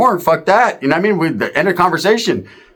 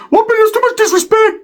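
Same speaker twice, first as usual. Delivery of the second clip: Ricky winding up. Retold or imitated. Ricky telling his cousin about disrespect, high and squeaky. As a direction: squeal it like an offended tough guy. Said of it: "when he starts talking like this".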